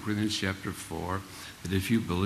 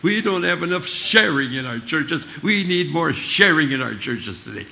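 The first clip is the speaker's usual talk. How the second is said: higher, louder beaming salesman pitch; glad-handing fellowship man; 7 semitones higher, 6 dB louder than usual. Retold or imitated. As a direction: sell fellowship with a big grin. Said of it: "And he'll say".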